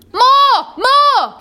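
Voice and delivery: very high squeal, as if vanishing upward; disappearing voice. A narrator's reading. Giant under the crushing bags, very high disappearing squeal. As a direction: push it to the top of the voice, almost squeezed away. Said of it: "his voice disappearing".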